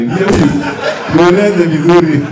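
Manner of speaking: usual speaking voice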